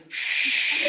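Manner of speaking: soft unvoiced shush; hush. Guide hushing the comic on safari, soft shush. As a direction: hush him like the tiger's near.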